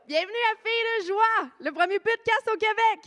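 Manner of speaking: big excited welcome; grand entrance announcement. Acted. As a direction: pump up the live crowd. Host opens the live show.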